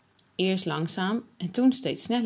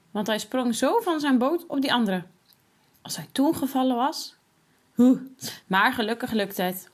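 ordinary delivery

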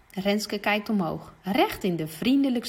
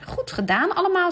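much higher and louder, praising; cheerful swim teacher; 10 semitones higher, 4 dB louder than usual. Acted. Juf Ellen praising the swimmers, cheerful. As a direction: praise the whole group.

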